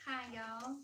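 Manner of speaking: Southern-accented greeting. Real, not acted. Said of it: "in a lovely Southern accent".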